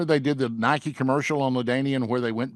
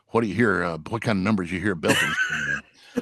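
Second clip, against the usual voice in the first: Kent Bechtel; 6 semitones lower than usual. Retold or imitated. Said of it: "and Kent was always".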